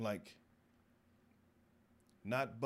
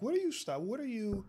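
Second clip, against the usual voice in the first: high, louder indignant challenge; bossy friend; 13 semitones higher, 5 dB louder than usual. Retold or imitated. Homegirl challenging the guards, high and indignant. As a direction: demand answers like a lawyer's daughter. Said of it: "she's always like".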